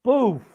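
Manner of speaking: loud sudden boof for the impact; a loud mock crash noise; crash sound. Crash sound in retold story.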